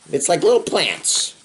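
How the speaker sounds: plain reading voice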